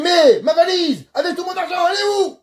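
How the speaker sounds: high, strained demand for the suitcase; a man rising in anger over his money; raising his voice. Retold or imitated. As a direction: push the pitch way up, pleading and angry. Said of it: "il commence à lever le ton".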